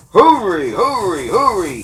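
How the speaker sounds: high, loud, hurried panting. A narrator's reading. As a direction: gasp the words out like a racing engine. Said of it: "he panted".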